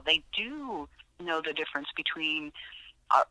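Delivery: normal talking voice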